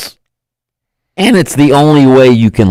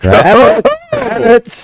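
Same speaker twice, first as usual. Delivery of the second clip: squeaky voice; much slower than usual. Acted. Odd exclaimed word, big pitch jump.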